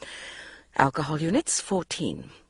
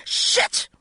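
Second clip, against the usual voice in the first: yelling. A narrator's reading.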